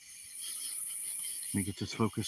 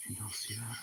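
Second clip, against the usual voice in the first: whispering; 16 dB quieter than usual. Real, not acted. Night filming, hushed to the viewers.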